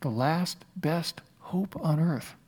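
very slow and soft, word by word; pointed repeat. Retold or imitated. Pastor questioning Lincoln's phrase, slow, quiet, pointed repeat. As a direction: hold the quote up for doubt, slowly. Repeats quote after doubting "Really?.

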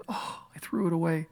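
soft, sighed lament; regretful. Retold or imitated. Dying man looking at his life, soft regret. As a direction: let it drop away softly in regret. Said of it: "with the regret of a lifetime".

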